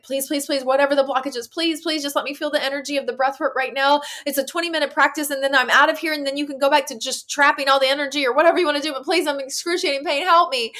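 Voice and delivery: high, urgent, rushed pleading; insistent plea, rising in pitch and force; retold begging. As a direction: act out bargaining desperately. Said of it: "I was begging my mind".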